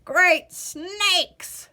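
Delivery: an ancient man croaking in alarm; old man croaking in shock. Acted. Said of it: "croaked the old man".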